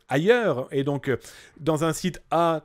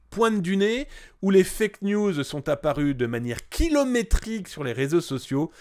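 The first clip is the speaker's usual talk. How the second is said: normal talking voice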